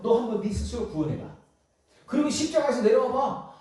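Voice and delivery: high-pitched, jeering sneer; mocking. Preacher acts out onlookers challenging Jesus to save himself, mocking. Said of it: "막 이렇게 조롱하는 거야".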